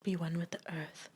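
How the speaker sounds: soft breathy mock-guru whisper. Whispered, unvoiced.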